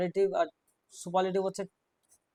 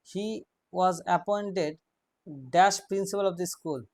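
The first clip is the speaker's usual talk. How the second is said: dictation; much slower than usual. Shown as a demonstration. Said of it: "dictating a fill-in question".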